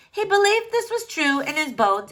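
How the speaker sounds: plain reading voice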